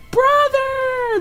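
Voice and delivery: squeaky imitated anime shout; anime cry. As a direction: cry out like Ed calling Al.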